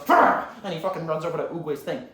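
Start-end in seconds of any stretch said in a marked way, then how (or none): 0.1-0.5 short, loud growled cry; explosive "rah" roar; villain roar. Acted. Villain lashes out at his master, sudden roar. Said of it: "Tai Lung goes".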